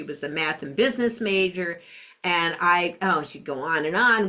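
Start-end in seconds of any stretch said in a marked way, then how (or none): none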